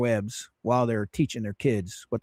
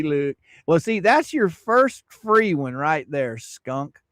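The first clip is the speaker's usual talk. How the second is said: ordinary delivery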